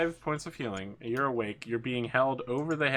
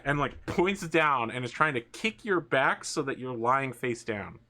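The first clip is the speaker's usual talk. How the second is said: usual speaking voice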